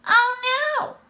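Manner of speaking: very high, loud wail; hammed-up, frantic despair; panicked cat burglar. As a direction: cry out in theatrical dread. Cat burglar panics over lost loot.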